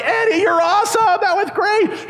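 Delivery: high-pitched excited cheer. Retold or imitated. Kids cheering their friend on the bike, excited high yell. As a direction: whoop it out like a thrilled kid. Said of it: "we're just cheering".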